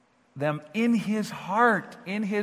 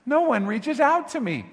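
mock complaint; 7 semitones higher, 4 dB louder than usual. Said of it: "he would just complain".

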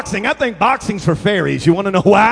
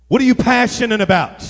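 ordinary delivery

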